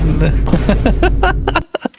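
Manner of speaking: high burst of laughter; real amused laughter; laughing. Real, not acted. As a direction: crack up, pitch climbing. Amused by side game request.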